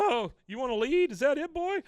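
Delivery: high, loud acted dog-talk; high-pitched acted plea. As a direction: raise the voice high and loud, imitating a man addressing his dog. Acting out man yielding to his dog.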